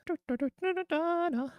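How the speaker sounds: put-on high sung anime girl voice; high cute anime girl. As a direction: sing it like a squeaky anime girl. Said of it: "weeb voice".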